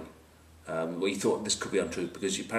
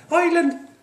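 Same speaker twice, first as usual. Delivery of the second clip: high, loud Irish yelp; mock Irish; 9 dB louder than usual. Joker naming the rugby teams, mock Irish shout. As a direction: call it out in a put-on Irish voice. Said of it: "do that when you speak Irish".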